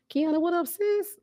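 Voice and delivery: high, bright call-out to a friend; calling out to a longtime viewer, high and excited; excited greeting. Real, not acted.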